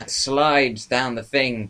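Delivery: ordinary talk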